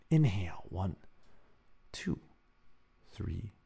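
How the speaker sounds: soft, calm counting voice; quiet meditation leader; guided breathing. Acted. Leads a breathing exercise.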